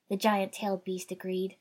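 plain reading voice

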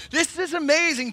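mock delight. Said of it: "it turned from".

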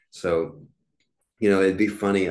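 ordinary talk

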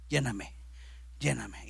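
low breathy whisper, begging; a low, whispered lléname; whispered plea. Retold or imitated. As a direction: whisper it like a desperate prayer. Retold years of begging prayer.

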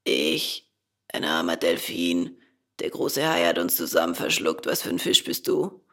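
low, husky dolphin voice; deep, croaky creature; hoarse dolphin. A narrator's reading. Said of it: "eine heisere Summe".

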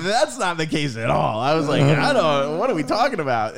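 loud, high protesting; mock denial. Worker mistaken for the boss, loud mock protest. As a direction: protest loudly as if wrongly blamed. Acted flustered denial to the woman.

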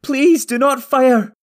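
slow, high, loud desperate plea; pleading. A narrator's reading. Mother begging Madorn's men, pleading loudly. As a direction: beg them at the top of the voice. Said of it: "Talgo's mother pleaded".

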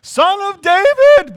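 loud, high desperate cry; acted shout. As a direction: cry out for help across a crowd. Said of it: "they shouted".

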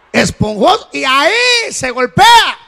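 loud, high cry as if striking; preacher shouting mid-demonstration; shouted demo. Acted. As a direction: belt it out like landing a blow. Preacher acting out spanking.

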